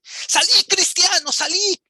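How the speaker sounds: shouting like the friar Valverde calling his soldiers to attack; priest's battle cry. Retold or imitated. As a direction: roar the order to seize the idolater. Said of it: "Valverde empieza a gritar y dice".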